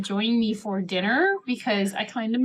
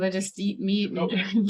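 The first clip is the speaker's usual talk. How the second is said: ordinary talk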